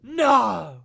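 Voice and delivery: high, slow, horrified proclamation; melodramatic shout. Acted. Revealing the villain, melodramatic horrified shout. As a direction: cry it out like a soap-opera reveal.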